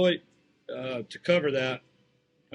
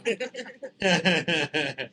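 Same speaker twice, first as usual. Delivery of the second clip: laughing. Real, not acted. Laughs after his Italian bit.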